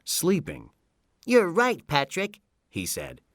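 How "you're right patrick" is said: high-pitched cartoon voice; high character voice with disappointment; disappointed SpongeBob. A narrator's reading. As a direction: concede it in a high, let-down cartoon voice. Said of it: "SpongeBob was disappointed".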